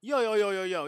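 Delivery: loud burst of yos; amazed interruption. Real, not acted. Reactor interrupting the replayed clip, amazed outburst. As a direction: cut in loudly, amazed.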